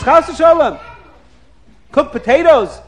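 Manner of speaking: loud, high incredulous exclamation; retold scandalized wife; appalled refusal. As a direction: recoil in mock horror at the request. Wife appalled at request to cook.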